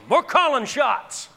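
rising preacher's shout, loud and high; fiery pulpit preaching; preaching at full force. Real, not acted. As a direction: build the sermon to a roaring climax.

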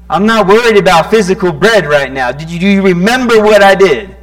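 loud and high, pleading with them; pleading rebuke. Acted. Jesus asking the disciples to remember, loud pleading rebuke. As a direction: demand it of them, voice climbing.

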